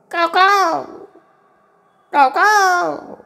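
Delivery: very high, drawn-out mock caw; Joshibuva's crow cawing. A narrator's reading. Man behind a tree faking crow calls, high cawing. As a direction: imitate a crow in falsetto. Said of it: "जोशीबुवाने आवाज काढला".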